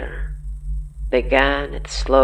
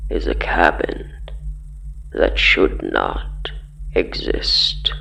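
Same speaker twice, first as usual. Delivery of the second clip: much lower, whispery and menacing; a low, rasping whisper from the dark; eerie low voice; 11 semitones lower than usual. Acted. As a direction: intone it like something lurking in the woods. Eerie voice describes the cursed cabin.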